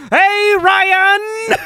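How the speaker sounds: sudden high shout, drawn out; uncontrolled volume. Retold or imitated. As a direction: blurt the name at full volume, imitating a hammy villain. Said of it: "could not control the volume of his voice".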